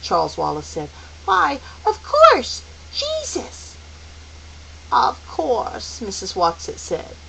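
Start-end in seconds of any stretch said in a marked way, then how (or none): 1.2-3.7 put-on very high, eager child voice; delighted discovery, pitched way up; excited realization. Charles excitedly realizing the answer. 4.9-6.0 drawn-out, reassuring agreement; gentle confirmation. Acted. Watsit calmly confirming Charles's guess.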